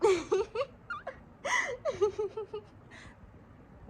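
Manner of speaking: shrieking laughter, high. Real, not acted. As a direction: burst into high cackling laughter. Friends react laughing.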